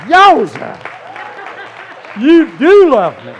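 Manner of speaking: very loud, high whoop of delight; hammed-up triumphant shout; overjoyed. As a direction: explode with comic joy. Overjoyed at sight of future wife.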